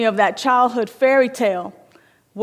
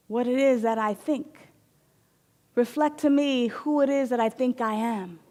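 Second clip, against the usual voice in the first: soft reflective invocation; 6 dB quieter than usual. Shown as a demonstration.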